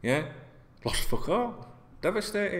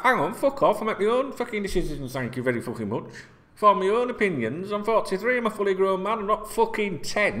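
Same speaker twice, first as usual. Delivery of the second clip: indignant retort; 8 semitones higher than usual. Retold or imitated. Imagined retort to being told off.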